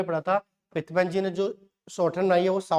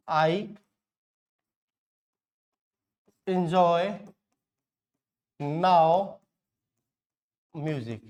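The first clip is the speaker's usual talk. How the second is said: each word alone, with long pauses between; word-by-word dictation; much slower than usual. Shown as a demonstration. Teacher writing out the memory phrase, word-by-word dictation. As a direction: say it piece by piece as you write. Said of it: "मैं यहाँ लिख देता हूँ".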